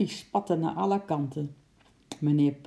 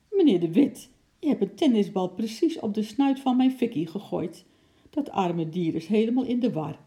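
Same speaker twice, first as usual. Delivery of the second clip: loud, higher indignant scolding; angry neighbour; 5 semitones higher, 4 dB louder than usual. A narrator's reading. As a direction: scold him for hurting her dog. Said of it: "de nijdige stem van zijn buurvrouw". (nijdig en verontwaardigd)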